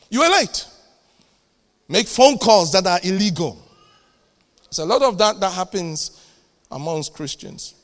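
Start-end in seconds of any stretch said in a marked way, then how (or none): 0.1-3.6 loud scolding retort; sharp rebuke. Acted. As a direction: call him out hard. Acted rebuke, repeated.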